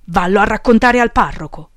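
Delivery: loud, indignant order; yelling. A narrator's reading. Boss sends his follower to the priest, yelling. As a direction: shout him off to someone else. Peppone keeps yelling at Fulmine.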